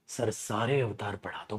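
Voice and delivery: lightly voiced pleading student, very soft; a kid begging sir to teach it all; mock student voice. (हल्की नकल करती छात्र की आवाज़)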